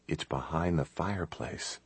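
very soft, hushed explanation; whispered. A narrator's reading. Chris telling Kate about the hidden passage, hushed. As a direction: keep the voice right down, hushed. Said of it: "He whispered".

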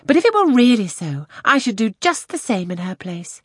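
put-on high, loud, ardent young lady; enthusiastic. A narrator's reading. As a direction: declare it with passionate enthusiasm. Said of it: "It was spoken with enthusiasm".

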